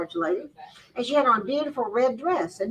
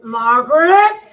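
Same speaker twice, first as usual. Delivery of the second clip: louder searching call, rising at the end; 3 semitones higher, 12 dB louder, much slower than usual. Retold or imitated. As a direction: call her louder, getting worried. Searching the room, calling louder.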